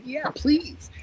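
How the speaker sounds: high, eager yes please. Retold or imitated. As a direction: pitch it up, keen to hear. Friend eager to hear advice.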